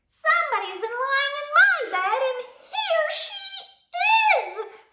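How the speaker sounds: very high squeak at full size; squeaky bear cub. A narrator's reading. Bear cub discovers the sleeper, squeaking his biggest. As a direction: squeak it big, stretching the ending. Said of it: "squeaked the bear cub in his biggest voice".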